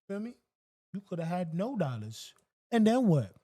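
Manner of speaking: rising rant that peaks on then what; emphatic lecturing. Real, not acted. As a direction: drive the point home at a buddy. Lecturing a small tipper.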